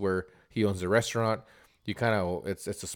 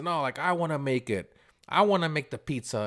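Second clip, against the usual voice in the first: raised-pitch mock demand; insistent retold protest; 6 semitones higher than usual. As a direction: imitate a stubborn customer, building on the repeat. Customer arguing in retold quarrel.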